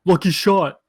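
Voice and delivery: taunting. Acted. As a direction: sneer at the loser. Todd taunts.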